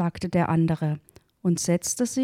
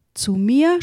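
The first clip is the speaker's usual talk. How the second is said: raised, higher voice for an exclamation; narrated cry; 5 semitones higher, 4 dB louder than usual. A narrator's reading. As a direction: cry out in mild disbelief, pitched up. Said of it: "schrie er".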